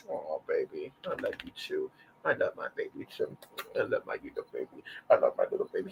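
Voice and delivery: tender whispered cooing. Real, not acted. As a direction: whisper love to the dog. Cooing to her dog.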